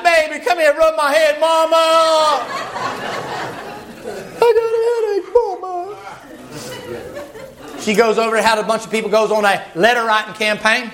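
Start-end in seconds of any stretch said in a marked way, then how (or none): 0.0-6.0 much higher, pleading whine for mama; whiny man-baby. Retold or imitated. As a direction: beg to have your head rubbed like a sick child. Men whining for their wives.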